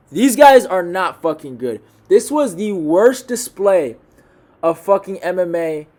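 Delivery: loud, slow, disgusted ranting; angry rant. Real, not acted. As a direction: spit out the disgust at the fighters. Ranting about a bad fight.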